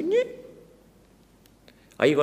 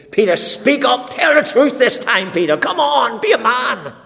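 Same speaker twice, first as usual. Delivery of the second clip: loud, much higher urging; shouting at the screen; 12 semitones higher, 8 dB louder than usual. Pretend. Viewer shouting at Peter on screen to tell the truth, loud and urgent. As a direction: yell encouragement at a character. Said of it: "shouting at the screen".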